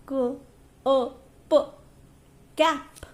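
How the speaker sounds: k, a, p said apart, then cap said whole; teacher breaks cap into sounds for kids; sounded out then blended. Shown as a demonstration. Teacher demonstrates segmenting to pupils, separate sounds. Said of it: "So this is our segmenting".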